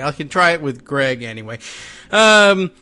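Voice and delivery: ordinary talk, nothing marked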